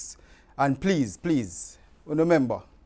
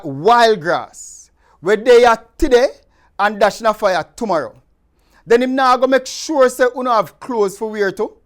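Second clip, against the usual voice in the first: higher, louder, preaching lilt in Jamaican Patois; scripture reading; 6 semitones higher, 6 dB louder than usual. Acted. Host reading Matthew six to his channel viewers, earnest scripture reading. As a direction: read the Gospel aloud like a fervent lay preacher.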